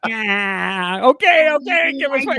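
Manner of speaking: high, loud nasal sitcom voice; shrill comic TV star voice; Fran Drescher voice. Pretend. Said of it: "use her acting voice to do the negotiation".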